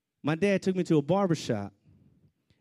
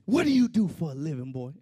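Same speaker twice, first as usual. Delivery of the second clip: louder, imitated police demand; demanding officer; 5 dB louder than usual. Officer questioning the driver in the squad car, demanding. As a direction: throw the question at him like an order.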